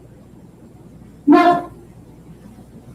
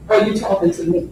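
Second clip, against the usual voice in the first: softer and lower "Are you talking to me?", landing down on "me"; low, quiet reply; 5 semitones lower, 6 dB quieter, much faster than usual. Retold or imitated. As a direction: drop the voice for the quote. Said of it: "her response to those allegations are".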